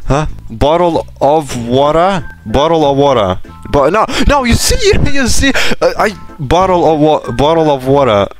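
usual speaking voice

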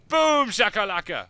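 loud, high, drawn-out cheer; loud, high victory cry as the explosion goes off; hyped shout. Real, not acted. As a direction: celebrate the blast with a big shout. Celebrating a detonation.

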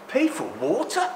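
normal talking voice

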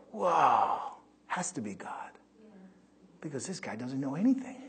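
a hushed, wondering mock onlooker; mock amazed listeners. Preacher imitating amazed listeners, sinking to a whisper. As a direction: gasp out the crowd's surprise, sinking to a whisper. People marvel at the weak speaker.

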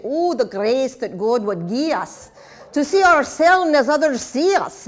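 a touch of Scottish lilt on the poem; Scottish accent. Shown as a demonstration. Teacher quoting Robert Burns to her class, in a Scottish accent. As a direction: recite the Burns line in a light Scottish accent so "see" and "us" rhyme. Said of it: "You have to say this with a Scottish accent in order to get the rhymes".

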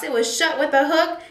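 usual speaking voice

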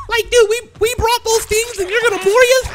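high, whiny mock plea; mock survivor. Mocking survivors complaining to the killer, high whine. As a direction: whine like a wronged teammate.

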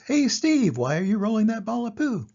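high, loud hail then question; curious warthog. Acted. Warthog hollers at Steve, high and loud. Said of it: "The warthog says".

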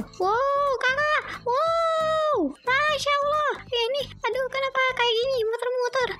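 put-on very high squealing dizzy kid voice; little sibling squealing on the spinning ride; dizzy ride squeals. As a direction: squeal as the ride spins you round.

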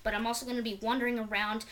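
fast rushed talk. Real, not acted. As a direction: hurry through the words. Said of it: "Sorry, I'm talking fast".

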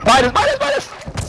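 urgent yelling call. Real, not acted. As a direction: yell the name to warn a teammate. Calling out a teammate mid-fight.

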